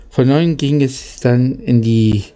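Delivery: plain reading voice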